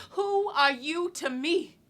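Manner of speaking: loud challenge. Acted. Woman challenging the man she robs, loud and defiant. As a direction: throw the slow question at him at full voice. Acted retort, repeated louder.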